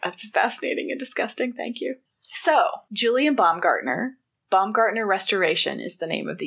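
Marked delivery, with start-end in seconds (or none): 0.0-1.9 breathy mock whisper. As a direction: whisper it, half amused. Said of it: "I'm like".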